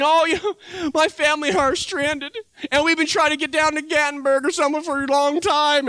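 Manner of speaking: stranded stranger. Retold or imitated.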